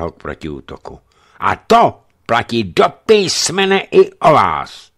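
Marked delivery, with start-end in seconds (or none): 1.4-4.9 slow, loud, higher venom; venomous accusation, slow and loud; venomous jab. A narrator's reading. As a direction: lay each word on slowly with venom. Jedovatý pohled.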